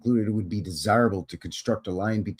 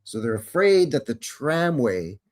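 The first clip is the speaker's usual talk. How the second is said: normal talking voice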